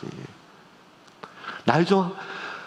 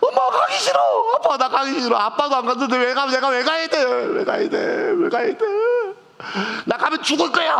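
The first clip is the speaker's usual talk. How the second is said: much higher, pleading whine of an unwilling conscript; whiny son; 11 semitones higher than usual. Pretend. Father imagining his son at boot camp, whining high. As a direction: beg like a child refusing to go. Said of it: "하고 막 이러고".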